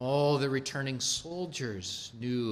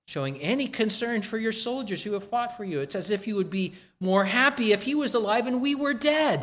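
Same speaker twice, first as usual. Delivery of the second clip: higher and faster, an outburst building to loud; rising rebuke; 9 semitones higher, 4 dB louder, faster than usual. Acted. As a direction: let the rebuke boil over. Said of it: "Joab came with a rebuke".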